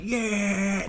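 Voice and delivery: high, delighted whoop; excited cheer. Retold or imitated. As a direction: cheer like watching the fight. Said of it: "even now I'm just like".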